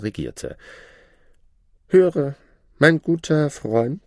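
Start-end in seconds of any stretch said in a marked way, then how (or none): 1.9-4.0 high, lordly and condescending; haughty shadow. Acted. The Shadow lording it over the learned man, haughty high voice. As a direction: talk down to your old master.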